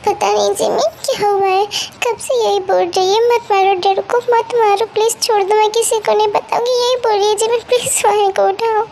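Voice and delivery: high-pitched, urgent fretting; panicked worry. Acted. Elder sister begs Jimin to wake the child, panicked. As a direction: report it anxiously and plead for help.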